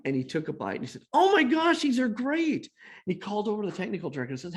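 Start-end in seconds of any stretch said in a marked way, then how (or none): 1.1-2.7 loud, high burst of delight; excited rave. Retold or imitated. Producer biting a hot cookie, acted as a high, delighted outburst. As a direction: rave about it at a jump in pitch.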